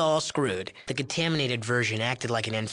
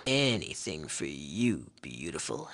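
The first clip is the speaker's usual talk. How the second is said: oily flirt; 10 semitones lower, 5 dB quieter than usual. Acted. Sleazy flirting with player.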